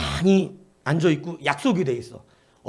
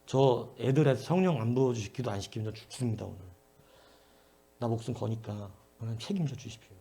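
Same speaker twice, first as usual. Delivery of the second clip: much softer, low pleading; hushed desperate prayer; 6 semitones lower, 6 dB quieter than usual. Retold or imitated. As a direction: pray under your breath, life on the line. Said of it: "밖에서 막 덜어내면서".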